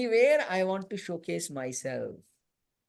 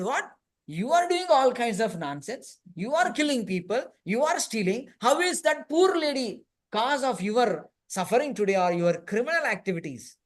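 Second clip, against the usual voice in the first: higher and louder, a scolding judge; indignant judge; 5 semitones higher, 5 dB louder than usual. Acted. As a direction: berate the criminal from the bench.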